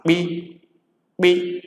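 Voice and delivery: "kpe" on a high held tone; KP pronounced "kpe". Shown as a demonstration. Igbo teacher demonstrating KP, "kpe" sound. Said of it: "K P is pronounced as".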